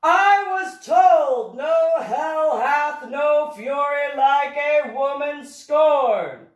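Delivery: loud, high, slow and drawn-out exasperation; frustrated outburst. A narrator's reading. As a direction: declaim it in frustration, stretching every word. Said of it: "the professor stated in frustration".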